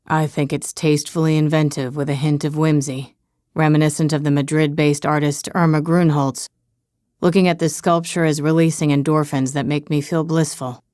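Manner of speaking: low flat bodyguard drone; deadpan, deep and monotone. Acted. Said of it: "in a super deep, monotone voice".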